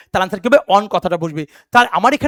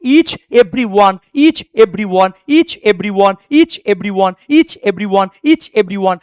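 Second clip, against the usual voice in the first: repeat-after-me drill; 4 semitones lower, much slower than usual. Shown as a demonstration. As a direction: lead the class in a steady, repetitive drill. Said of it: "আমার সাথে আবার repeat করো". (ধীরে ধীরে তালে তালে মুখস্থ করানোর ভঙ্গি)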